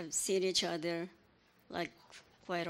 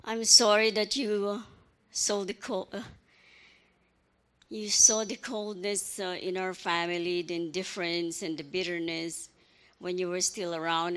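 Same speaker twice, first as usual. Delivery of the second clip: louder, strained apology fighting back tears; emotional apology; 7 dB louder than usual. Real, not acted. Aunt apologizing to her late nephew, emotional and strained. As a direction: push through the emotion, voice straining. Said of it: "getting emotional".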